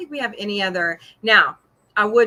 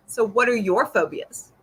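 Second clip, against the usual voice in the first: ordinary talk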